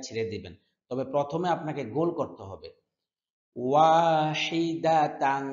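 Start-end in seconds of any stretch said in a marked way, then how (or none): 3.5-5.5 slow melodic chanted recitation; Quran recitation. Shown as a demonstration. Teacher chanting verse slowly for students. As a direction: chant each word drawn out for students to repeat.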